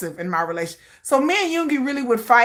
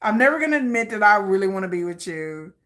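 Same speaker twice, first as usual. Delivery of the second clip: ordinary talk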